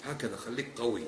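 ordinary talk, nothing marked

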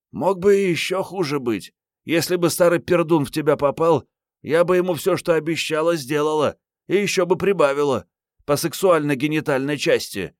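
put-on high young woman's voice, brash and chatty; бойкая героиня книги. A narrator's reading. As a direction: rattle off the bold promise in a girlish pitch. Героиня хвастливо рассуждает. (высоким девичьим голосом, дерзко)

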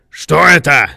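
loud demanding question; startled shout. A narrator's reading. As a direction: shout it in alarm. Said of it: "крикнул он".

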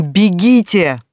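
громкий крик, выше обычного; выкрикни приказ во весь голос; крик не своим голосом. Acted. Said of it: "заорала не своим голосом". (отчаянный крик)

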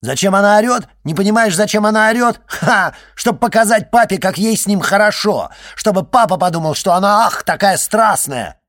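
high, very loud sarcastic tirade; scornful shouting. A narrator's reading. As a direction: yell with jeering contempt. Алиса орёт о Жанне в ссоре. (язвительно кричать)

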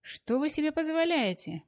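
high outraged cry; outraged shriek. Acted. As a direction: shriek in protest at the blow. Sonechka outraged at policewoman.

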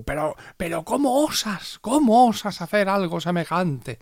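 high, slow scandalized voice; mock outrage. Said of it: "es como".

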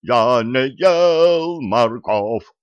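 very slow, loud soprano squeal. A narrator's reading. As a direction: sing it out in soprano. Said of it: "великолепнейшим сопрано".

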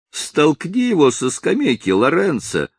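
high, goading yell; rowdy shout. Acted. Drovers urge their strong friend Lorenzo, shouting high. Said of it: "закричали они".

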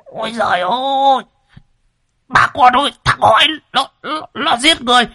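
strangled high cries building to loud screams for help; high, desperate yelling of someone being throttled; choked roaring. A narrator's reading. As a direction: gasp and stammer, then shriek for rescue. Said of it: "gầm lên như là cái máy cày sòng sọc".

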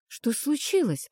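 ordinary delivery, nothing marked

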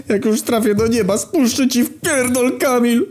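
very high, wailing mock sob; crying. A narrator's reading. Dying narrator addressing dead Kamil, high weeping wail. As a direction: wail a tearful last threat. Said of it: "Rozpłakałem się".

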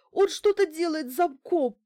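high, loud panicked cry; hysterical. A narrator's reading. Bankina hearing someone at her lock, hysterical. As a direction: cut in with a high, frantic cry. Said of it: "прорезал её истерический голос".

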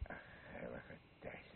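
low soft whispered curse; whispered oath. Acted. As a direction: breathe the oath out under your breath. Mutters an oath.

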